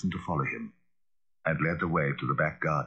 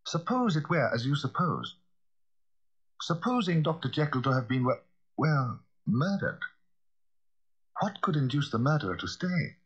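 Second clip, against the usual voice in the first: higher-pitched reasoning gentleman; sceptical lawyer; 8 semitones higher than usual. Acted. Lawyer challenges the butler's murder theory, sceptically. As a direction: argue against it, pitch raised.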